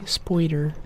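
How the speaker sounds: spoider with an oi sound; Irish-style spoider. Shown as a demonstration. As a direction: say spoider, oi like in boy. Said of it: "We say spoider".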